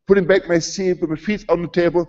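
ordinary delivery

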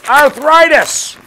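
high, drawn-out yell; big comic shout; shouted punchline. Acted. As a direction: blast the word out loud and long. Joke punchline bellowed out.

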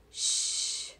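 long hushing "sh"; drawn-out unvoiced "sh"; sh sound. Shown as a demonstration. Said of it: "Miss Henry's favorite".

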